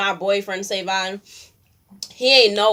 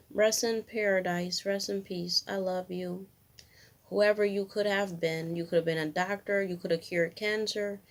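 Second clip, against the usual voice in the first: much softer, tender, mournful; grieving farewell; 9 dB quieter than usual. Real, not acted. Farewell to lost baby.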